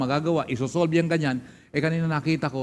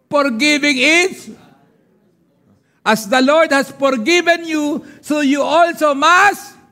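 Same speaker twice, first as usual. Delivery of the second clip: slow, loud, high preaching read; preaching scripture; 10 semitones higher, 9 dB louder, slower than usual. Acted. Pastor proclaims scripture to congregation, loud and slow. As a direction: proclaim the verse to the church.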